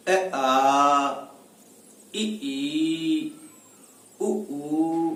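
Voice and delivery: short अ, इ, उ, each followed by held आ, ई, ऊ; short then long vowels. Shown as a demonstration. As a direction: hold आ, ई, ऊ twice as long as अ, इ, उ. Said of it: "ह्रस्व उ से दीर्घ उ में दुगुना समय लगता है".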